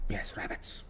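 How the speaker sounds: excited hushed whisper. Real, not acted. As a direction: whisper it like spotting prey. Spots rabbits, whispers excitedly.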